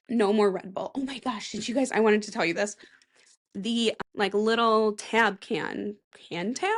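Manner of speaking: ordinary talk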